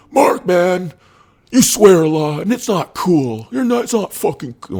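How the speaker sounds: high, loud mock scolding of a swearer; scolding minister. Minister telling him off for swearing, high loud mock scold.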